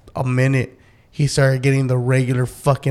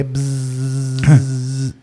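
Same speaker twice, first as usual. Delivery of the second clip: mosquito buzz; 2 semitones lower than usual. Shown as a demonstration. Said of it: "Mosquitoes flying by".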